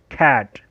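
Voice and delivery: कैट with a hard k, "kat". Shown as a demonstration. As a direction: say कैट with a crisp k sound. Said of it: "जैसे सी A T".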